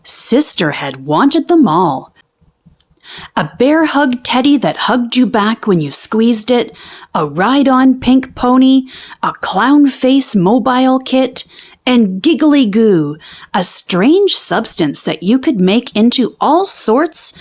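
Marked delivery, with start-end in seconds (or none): none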